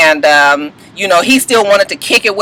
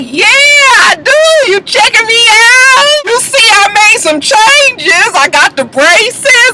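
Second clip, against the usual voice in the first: high, slow, drawn-out imitation; mock flirty show-off; 10 semitones higher, slower than usual. Bartender showing off her braces, high drawn-out mock flirting. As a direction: stretch every word in a squealed imitation. Mimicking Shay showing off to Stevie.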